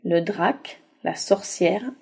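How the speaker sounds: plain reading voice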